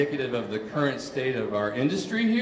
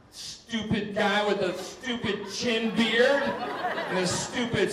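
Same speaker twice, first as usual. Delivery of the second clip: higher, slow, sarcastic tirade; mock rant; 6 semitones higher than usual. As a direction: drag out every stupid with mock contempt. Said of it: "And I'm like".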